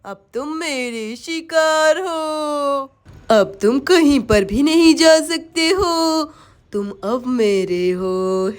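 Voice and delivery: low, very slow witch voice; possessive witch. Acted. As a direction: gloat over captured prey, stretching each word. Witch claims her prey. (चुड़ैल की धीमी, भारी धमकी)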